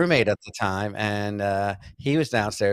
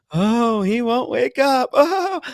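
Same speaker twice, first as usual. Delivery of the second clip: high, drawn-out mimicry of her; loud falsetto-style mock lament; mock wailing woman; 11 semitones higher, 5 dB louder, much slower than usual. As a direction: imitate her in a slow, high-pitched wail. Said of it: "she keeps going".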